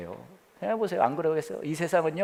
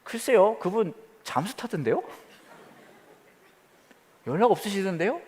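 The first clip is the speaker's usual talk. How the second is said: put-on baby's cheeky reply; 8 semitones higher, 4 dB louder than usual. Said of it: "그 아기가 하나님한테".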